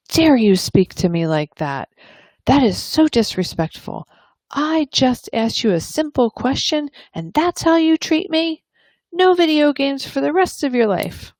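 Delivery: high, scolding rant rising as it goes; angry parent. Acted. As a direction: tell the teen off in a rising fury. Stomp up the stairs ... and say.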